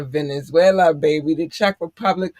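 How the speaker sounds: normal talking voice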